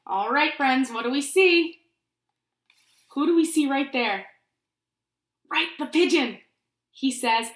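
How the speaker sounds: ordinary delivery, nothing marked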